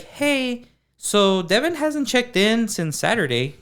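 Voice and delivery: high, drawn-out mock phone voice; worried wife. Wife phoning the boss about missing husband, worried.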